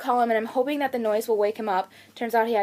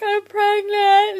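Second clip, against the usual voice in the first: high, loud, drawn-out mock sob; retold tearful wail; 9 semitones higher, 6 dB louder, much slower than usual. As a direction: wail it slowly, imitating losing the fight with tears. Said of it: "trying not to cry".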